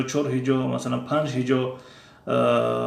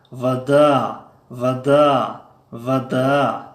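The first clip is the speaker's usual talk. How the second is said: each word said louder, with a falling tune; model reading aloud; 4 dB louder, much slower than usual. Shown as a demonstration. Teacher models word list for students to repeat, loud and deliberate. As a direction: read the words slowly and deliberately, for the class.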